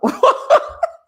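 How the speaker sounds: loud, high burst of laughter. Real, not acted. Laughing at own story.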